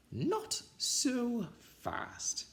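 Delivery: slow, high, nasty hiss of a witch. A narrator's reading. The new witch teacher stops the unruly kids, slow and hissing. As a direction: drag out each word like a menacing witch. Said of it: "hissed an unpleasant voice".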